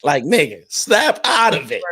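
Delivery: half-laughing snap out of it, stretched out; laughing self-scolding. Retold or imitated. Host retelling crying in the car, laughing scold to himself. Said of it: "I'm sorry, that's our word".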